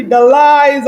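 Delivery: usual speaking voice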